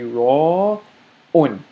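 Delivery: "ra un" with a throaty Arabic r; Arabic syllables sounded out. Shown as a demonstration. Teacher sounding out the last part of the word. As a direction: read "ra un" clearly. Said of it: "let's read this ra".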